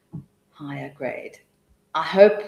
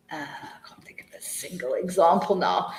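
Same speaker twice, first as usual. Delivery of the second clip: whispered aside; 4 dB quieter than usual. Real, not acted. Aside, searching for example.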